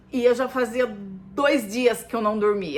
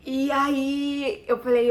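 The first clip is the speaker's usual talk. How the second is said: crying; 4 semitones higher, 5 dB louder, slower than usual. Real, not acted. Mother telling of the call about her son, crying. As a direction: voice breaking into a real cry, slowed down.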